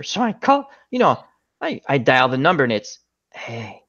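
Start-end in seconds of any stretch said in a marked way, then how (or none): 3.3-3.8 a hint of a soft, seductive recorded voice; lightly voiced come-on, much softer, slow; sultry phone line. Dialing the warranty number reaches a sex line, soft come-on.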